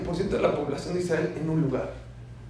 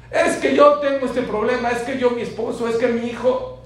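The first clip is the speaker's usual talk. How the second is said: much higher, louder whiny plea; complaining worshipper; 8 semitones higher, 9 dB louder than usual. Acted. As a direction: play a worried person piling up problems. Preacher voices a complaining worshipper.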